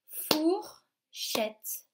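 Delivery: "fourchette" split four, pause, chette; high "fourchette", broken clearly in two; syllable by syllable. Shown as a demonstration. Teacher counts two syllables for children, "fourchette" split syllable by syllable. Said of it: "Il y a deux syllabes dans ce mot".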